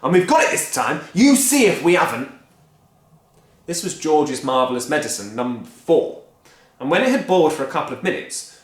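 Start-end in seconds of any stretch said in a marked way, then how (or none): 0.0-2.3 stubborn husband. Acted. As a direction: insist you'll get it right. Mister Cranky insisting.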